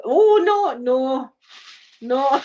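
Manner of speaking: high, loud mock dismay easing down; hammed-up squeamish protest; retold horrified refusal. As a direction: act out recoiling in horror. Retold refusal of gruesome pictures.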